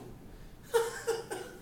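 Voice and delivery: short, high, breathy bitter laugh; bitter laugh at being asked what's wrong. Acted. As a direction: laugh in disbelief.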